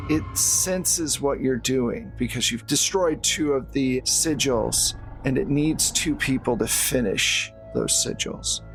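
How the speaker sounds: ordinary talk, nothing marked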